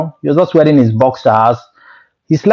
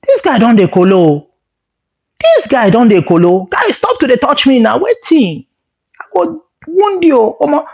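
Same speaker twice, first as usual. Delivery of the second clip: rising mock fury, much higher; angry threat; 8 semitones higher than usual. Guy warns his touchy roommate, rising mock anger. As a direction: warn him off, getting heated.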